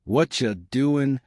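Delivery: "What ya doing" as one cluster; final linked version. Shown as a demonstration. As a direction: blend "What ya" into "whatcha". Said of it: "Final version".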